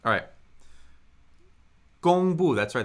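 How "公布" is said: 公布 loud, high level 公 then falling 布; teacher confirming the tones of 公布; first and fourth tones. Shown as a demonstration. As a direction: punch out 公布, high and flat then down. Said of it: "That's first tone, fourth tone".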